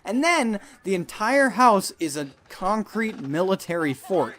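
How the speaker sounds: ordinary talk, nothing marked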